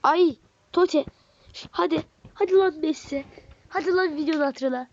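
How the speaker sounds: eager hyped pleading; excited pleading. Real, not acted. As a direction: beg the pack for Messi. Begging for a better card.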